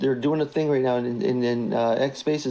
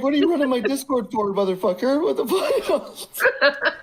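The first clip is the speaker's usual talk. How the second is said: high, laughing mock rage, dragged out at the end; hammed-up furious boss, cracking up; mock outrage; 10 semitones higher, slower than usual. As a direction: screech it like a livid boss who can't keep a straight face. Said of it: "You're like".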